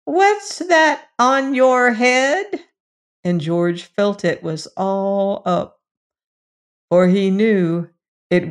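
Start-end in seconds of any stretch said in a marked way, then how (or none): 0.0-2.7 high, slow creature voice; slow, higher, louder dwarf question; chief dwarf. Acted. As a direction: menace slowly as the chief dwarf. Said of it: "the chief dwarf".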